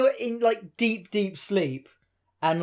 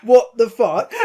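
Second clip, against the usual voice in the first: louder, laughing incredulous cry; laughing disbelief; 3 semitones higher, 8 dB louder, slower than usual. Retold or imitated. Hearing the drunk mate insult a stranger, loud laughing disbelief. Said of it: "I was like".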